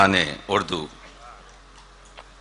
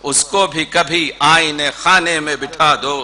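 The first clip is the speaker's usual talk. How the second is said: measured mushaira couplet, falling at its close; Urdu poetry recitation; 5 semitones higher, 5 dB louder than usual. Acted. Poet repeats a couplet for an appreciative audience, recited. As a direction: declaim it line by line.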